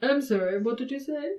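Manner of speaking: lightly acted pedantic judge, rising at the end; mildly passive-aggressive query. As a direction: feign not having heard, pedantically. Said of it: "Which is passive-aggressive and pedantic".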